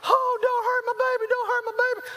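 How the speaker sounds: high-pitched mock panic of a worried mother; hammed-up frantic mom; freaking-out wife. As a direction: plead in an exaggerated falsetto wail. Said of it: "My wife starts freaking out".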